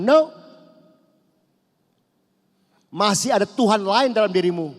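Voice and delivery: high, loud emphatic no; sharp refusal. Acted. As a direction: cut them off. Voicing God's reply. (Tuhan menolak dengan suara tinggi dan keras)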